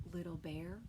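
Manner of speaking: plain reading voice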